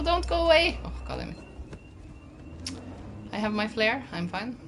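ordinary talk

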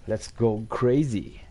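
hyped and drawn out; slow, loud hype call; excited. Acted. Gaming excitement, English exclamation. (aufgedreht und langsam)

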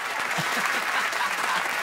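airy burst of laughter; breathy laugh. Real, not acted. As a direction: laugh out at the joke. Laughing at own joke.

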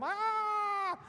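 long, high, loud scream; hammed-up sustained shriek; mock scream. Rider in the cylinder as water rises, mock scream. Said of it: "Some of you are like this".

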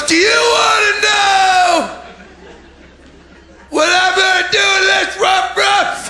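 high shouting market trader; hammed-up market seller yell; meat van hawker. Meat seller teasing crowd.

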